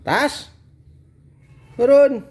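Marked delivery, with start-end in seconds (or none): 1.7-2.2 loud, high order to get down; sharp command. Real, not acted. Dad ordering daughter to get down, loud and sharp. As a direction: call her down firmly.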